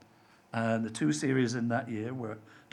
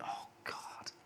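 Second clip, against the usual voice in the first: whispered dismay; horrified thought; 8 dB quieter than usual. Retold or imitated. Writer thinking his casting is a disaster, hushed horror. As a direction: breathe it out in a despairing whisper. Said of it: "I can remember thinking".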